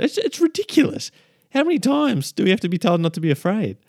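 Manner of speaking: high, amused disbelief; incredulous. Real, not acted. Preacher marvelling at being told again, high amused disbelief. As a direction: squeak it out in disbelief.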